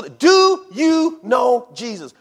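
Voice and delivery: high, slow, word-by-word question; emphatic question. Acted. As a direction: hammer each word out slowly and high. Repeats question over the excuses.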